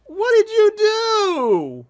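put-on baby voice. Baby voice teasing teammate.

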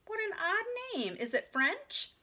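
high-pitched, puzzled society lady; surprised exclamation. A narrator's reading. Lady puzzles over the odd name, high and surprised. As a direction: wonder aloud in a high voice. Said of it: "exclaimed the lady".

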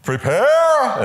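cue the dancer sharply; dance instructor. Retold or imitated. Dance instructor in Billy Elliot cueing Billy, sharp call. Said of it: "would always say".

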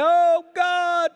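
hammed-up prophets' plea to the sky; mock moaning cry. False prophets crying out to their god, mock wail. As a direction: wail it high and drawn out. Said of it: "They began to moan".

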